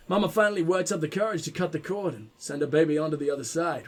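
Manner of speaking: usual speaking voice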